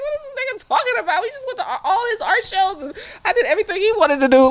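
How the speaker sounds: high, fast, flustered retelling of her protest; mock upset girlfriend, high and quick; upset and confused. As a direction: rattle it off high and fast, baffled. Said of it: "so upset and confused".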